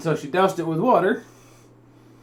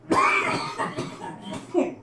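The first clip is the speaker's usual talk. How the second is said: dry hacking cough; harsh coughing fit; 12 semitones higher, 4 dB quieter than usual. Real, not acted. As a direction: cough hard, throat burning. Choking on pepper smoke.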